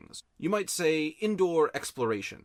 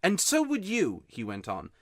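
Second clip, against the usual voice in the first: lightly voiced boy, a touch higher, aggrieved; upset boy; 7 semitones higher, 3 dB louder than usual. A narrator's reading. Homesick boy grumbles to Polly, lightly voiced. Diggory complaining.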